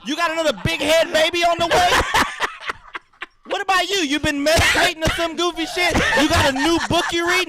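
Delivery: loud, high, laughing taunts; mocking roast. Real, not acted. Roasting his friend about books and meditation, loud and laughing. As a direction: needle him, voice up and loud with laughter.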